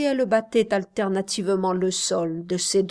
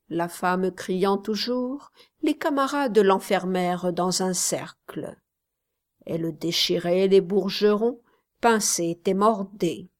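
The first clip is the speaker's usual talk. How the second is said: ordinary delivery, nothing marked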